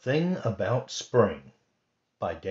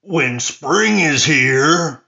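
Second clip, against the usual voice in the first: excited; 6 semitones higher, 11 dB louder, much slower than usual. Acted. Friends cheer spring together.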